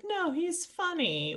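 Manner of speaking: sing-song defense of the dad, drawn out; imitating everyone else defending the dad; mock defense. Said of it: "everyone else is like".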